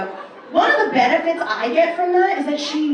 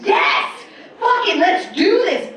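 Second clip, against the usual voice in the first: excited yell; 6 semitones higher, 4 dB louder, slower than usual. Retold or imitated.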